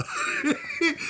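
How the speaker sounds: high giggling burst; real high-pitched laughter. Real, not acted. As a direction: crack up at your own joke. Laughs at own haggling joke.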